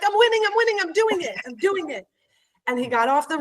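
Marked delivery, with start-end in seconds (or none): 0.0-2.1 very high, thrilled chant that winds down; giddy triumph. Retold or imitated. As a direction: squeal it like you just won. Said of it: "I'm like".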